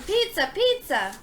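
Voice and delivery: calling out. A narrator's reading. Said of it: "The man ran upstairs yelling".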